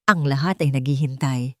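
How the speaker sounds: ordinary delivery, nothing marked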